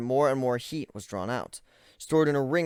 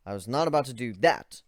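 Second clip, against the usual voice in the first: plain reading voice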